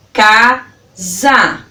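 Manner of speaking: CASA split into ca - za with a pause; syllable by syllable. Shown as a demonstration. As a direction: open the mouth twice for CASA, ca, za. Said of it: "Fale como você pronuncia ela".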